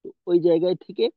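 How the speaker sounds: ordinary talk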